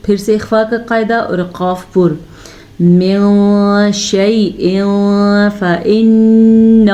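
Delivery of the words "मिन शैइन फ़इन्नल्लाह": slow melodic tajweed chant; Quran recitation. Shown as a demonstration. Teacher demonstrates the verse for students, slow chant. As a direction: draw each word out in a chant.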